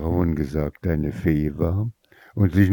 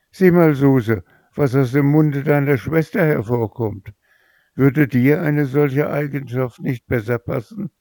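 eager greedy mother; 7 semitones higher than usual. Acted. Mother urges her favourite daughter.

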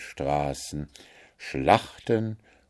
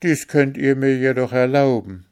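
pleading; 6 semitones higher, 5 dB louder than usual. A narrator's reading. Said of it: "bat Märchen".

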